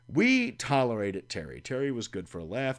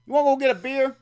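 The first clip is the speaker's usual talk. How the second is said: much higher, louder and fast; imitating Terry; 14 semitones higher, 10 dB louder, much faster than usual. Terry pushing a late-night beer, high and fast. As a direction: toss off the offer eagerly. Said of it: "My phone would ring at six A M".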